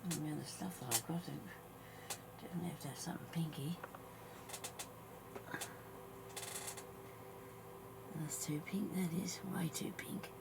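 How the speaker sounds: soft whispered muttering; whispering. Real, not acted. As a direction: whisper to yourself while working. Muttering quietly while crafting.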